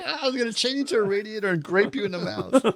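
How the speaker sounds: line quoted while laughing; laughing quote. Retold or imitated. Retelling a skit line to a friend, laughing throughout.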